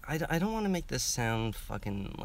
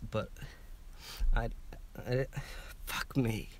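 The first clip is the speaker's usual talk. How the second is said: crying; 3 semitones higher than usual. Real, not acted. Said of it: "I'm gonna start crying".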